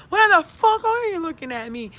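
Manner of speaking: loud, high-pitched mock confrontation; acted mad. Vlogger acting out snapping at onlookers, mock fury. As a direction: lash out at a staring stranger, acted. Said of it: "And then I'll be mad".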